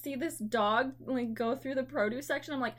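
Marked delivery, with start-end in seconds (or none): none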